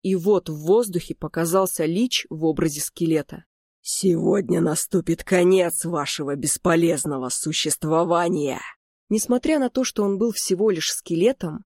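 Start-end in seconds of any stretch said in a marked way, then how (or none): 3.8-8.6 menacing undead lich proclamation; doom spoken by a skeletal sorcerer; inhuman voice. Acted. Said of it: "нечеловеческий голос".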